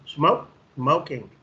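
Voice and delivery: slow, deliberate teacher model of each form; slow modelling. Shown as a demonstration. Teacher modelling for students: slow and deliberate. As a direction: lay out both words slowly for the class. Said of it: "teacher modelling verb and gerund".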